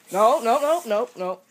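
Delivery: loud panicked no, rising high; alarmed shout. Real, not acted. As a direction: shout to stop him right now. Friend about to do something bad.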